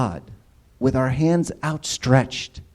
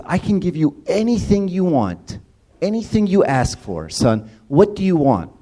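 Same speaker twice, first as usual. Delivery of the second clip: higher, grand, open-handed king voice; generous king; 7 semitones higher than usual. Retold or imitated. The Roman emperor asks his subject what he wants, grand and generous. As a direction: play the emperor offering the world.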